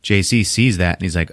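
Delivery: ordinary delivery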